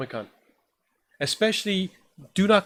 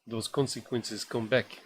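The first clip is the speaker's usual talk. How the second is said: put-on Russian accent; 3 semitones lower, 6 dB quieter than usual. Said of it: "I'm gonna stop trying to do a Russian accent now".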